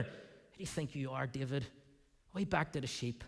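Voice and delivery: put-on older brother quietly belittling David; scornful brother.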